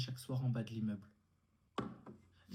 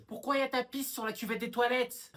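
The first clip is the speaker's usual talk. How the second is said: loud, raised-pitch accusation; angry scolding; 6 semitones higher, 6 dB louder than usual. Acted. Sister confronts brother about the dirty toilet, angry scolding. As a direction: demand an answer loudly.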